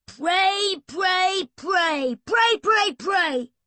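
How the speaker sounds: loud, high chant of pray while winding; frantic shout. Acted. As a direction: yell it in a frenzy at the machine.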